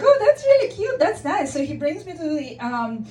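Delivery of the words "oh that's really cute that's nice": high, louder coo of delight; delighted gushing. Retold or imitated. Woman thinks her crush came back for her, delighted. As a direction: gush sweetly, pitched well up. Smitten reaction to being carried off.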